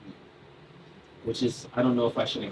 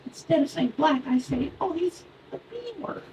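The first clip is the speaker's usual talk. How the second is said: put-on very high kid voice, dropping softer; Tommy; 3 dB quieter than usual. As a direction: imitate a first-grader explaining a secret code word. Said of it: "He's like".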